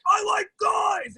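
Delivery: shouted declaration. Retold or imitated. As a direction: yell it like you're trying too hard. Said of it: "and I was like".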